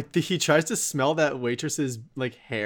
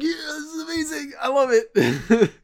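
mock cheering; 12 semitones higher than usual. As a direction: whoop it up like a delighted pub crowd. Mimicking cheering Irish pub crowd.

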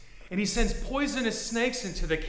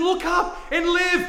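loud, high, slow shout of look up and live; yell; 10 semitones higher, 8 dB louder than usual. Retold or imitated. Yelling at a dying friend to look up and live. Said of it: "you just want to yell at them".

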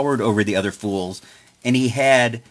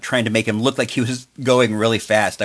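ordinary talk, nothing marked

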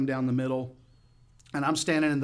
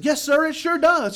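high, loud, emphatic agreement; excited shouted reply; 9 dB louder than usual. Retold or imitated. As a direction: shout back your agreement.

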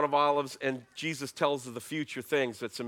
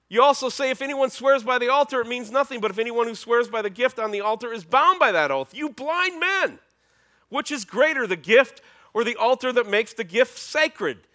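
loud, high preaching rebuke; Jesus raising his voice; 9 semitones higher, 7 dB louder than usual. Acted. Jesus rebuking the Pharisees before the crowd, loud. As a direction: hammer each charge home. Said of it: "he's gonna raise up his voice".

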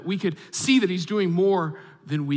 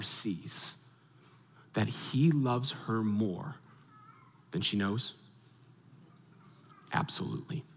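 slow and soft, sinking lower with long pauses; hushed tenderness; 6 semitones lower, 6 dB quieter than usual. Real, not acted. Preacher to congregation on God loving his daughter, hushed tenderness. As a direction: let it settle gently, almost to a hush.

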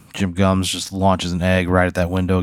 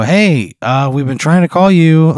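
exasperated dispatcher; 8 semitones higher, 7 dB louder than usual. Acted. Acted dispatcher phoning back, exasperated.

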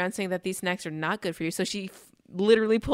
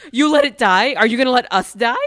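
much louder hammed-up accusation; mock outrage; 4 semitones higher, 12 dB louder than usual. She accuses Ben over the dead fern, loud mock outrage. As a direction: blow it up into a melodrama.

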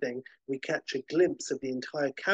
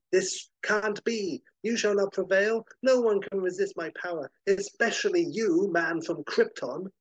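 gloating supervillain; 6 semitones higher than usual. Acted. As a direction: boast like a mad genius who thinks he's won. Villain refuses defeat.

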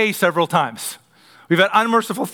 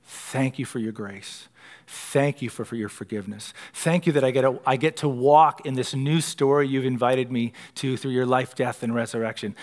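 quiet prayer; 7 semitones lower, 6 dB quieter than usual. Pretend. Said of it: "Daily come to Christ and say".

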